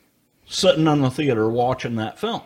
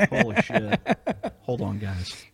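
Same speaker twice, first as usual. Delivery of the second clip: laughing; 6 semitones higher, slower than usual. Real, not acted. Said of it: "need to catch my breath".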